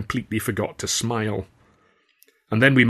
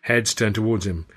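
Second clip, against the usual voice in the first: ordinary delivery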